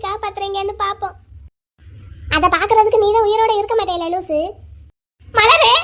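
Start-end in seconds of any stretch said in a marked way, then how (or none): 5.3-5.7 loud wailing call of a name; anguished cry. Acted. A mother cries out to her elder daughter in a loud wail.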